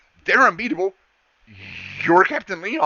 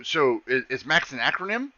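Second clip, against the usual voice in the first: usual speaking voice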